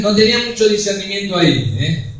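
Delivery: normal talking voice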